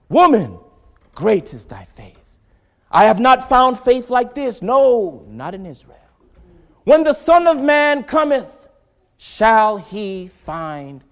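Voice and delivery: very loud preaching, pressing hard; rising preaching shout. Real, not acted. Preacher warning the congregation, near shouting. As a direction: hammer it home to the church.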